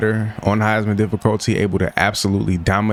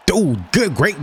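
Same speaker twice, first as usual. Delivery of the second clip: a sudden high yelp of delight; excited yelp; 9 semitones higher, slower than usual. Real, not acted. Gamer yelps at a teammate's diving catch, high and excited. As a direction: pop the word up high in surprise.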